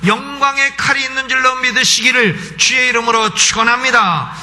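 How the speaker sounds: high, insistent pulpit voice; forceful preaching. Acted. Preacher urging the congregation not to fear the devil, forceful preaching. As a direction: drive each clause up to a blessing.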